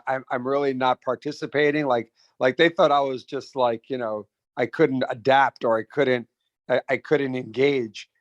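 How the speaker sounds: ordinary talk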